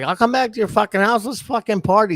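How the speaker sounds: mock the excited girl; hammed-up party invitation. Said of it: "And she's like".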